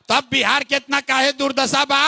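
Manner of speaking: usual speaking voice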